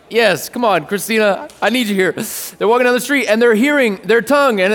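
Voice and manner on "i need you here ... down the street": normal talking voice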